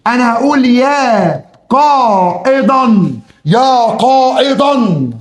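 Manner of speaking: يا قائدا stretched slowly, ending on a long open -dan; slow drawn-out call. Shown as a demonstration. Teacher demonstrating the call slowly to students. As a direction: call out يا قائدا like summoning someone far off. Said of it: "بص انا هنا هعمل ايه".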